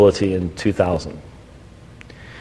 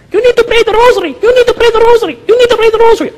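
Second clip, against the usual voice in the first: put-on much higher, louder, shrill-free squeaky old lady chant; nagging little lady; 6 dB louder, faster than usual. Little lady following the debater, repeating a high loud nag. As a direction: hound him like a pestering churchgoer. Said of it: "that little lady followed me going".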